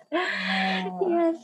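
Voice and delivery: a high yes said through the laugh. Real, not acted. Guest still laughing at the host's praise, says yes through laughter. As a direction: agree while still laughing.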